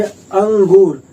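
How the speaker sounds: अंगूर with the nasal hum of अं clearly sounded; nasal demo. Shown as a demonstration. Teacher models अंगूर for students, nasal stressed. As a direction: say अंगूर letting the अं ring through the nose. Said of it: "आप उच्चारण कीजिए".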